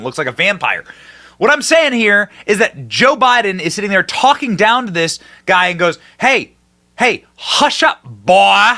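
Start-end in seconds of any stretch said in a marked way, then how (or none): 6.2-8.7 imitated Southern drawl, higher, drawn out; mock a plantation owner's Southern drawl; high, slow mock-Southern command; Southern accent. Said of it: "he says it in a Southern accent".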